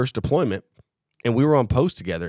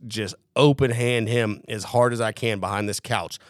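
normal talking voice

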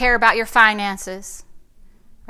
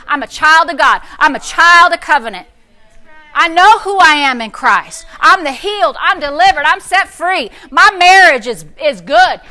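preaching declaration; 2 semitones higher, 7 dB louder than usual. Acted. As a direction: declare it to the congregation. Louder confession.

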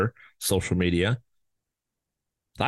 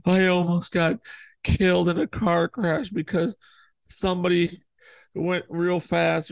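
raised, breathless "I almost got killed" drama; mock dramatic panic; 9 semitones higher than usual. Mocking a dramatic social media post, hammed up and raised. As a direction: overplay a near-miss story.

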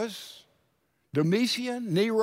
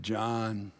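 tender reassurance; 11 semitones lower, 9 dB quieter, slower than usual. Acted. Jesus comforting John in vision.

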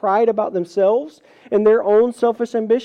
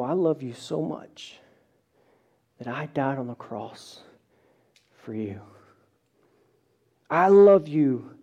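tender Jesus; 5 semitones lower, 3 dB quieter than usual. Retold or imitated. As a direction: pour out love quietly.

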